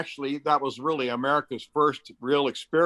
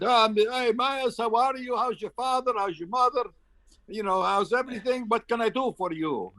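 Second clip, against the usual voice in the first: much higher, chummy voice of a powerful man; friendly minister; 10 semitones higher than usual. Retold or imitated. The minister greeting Miles on the phone, high and breezy. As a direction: greet him like an old family friend. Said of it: "and he said".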